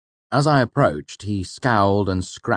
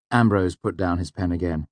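plain reading voice

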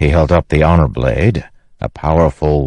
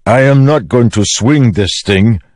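higher, emphatic foreign character, exasperated; Rock; 8 semitones higher, 3 dB louder than usual. Acted. Rock answers Teft's doubt about the weapon, exasperated. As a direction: refuse it with exasperation. Rock refuses, rolling his eyes.

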